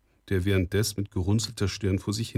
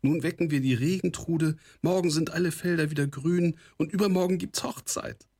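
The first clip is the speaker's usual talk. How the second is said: put-on high-pitched, eager young bride; joyful excitement; 9 semitones higher than usual. A narrator's reading. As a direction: bubble over with happy plans. Girl rejoices at coming wedding. (freudig aufgeregt, mit hoher Stimme)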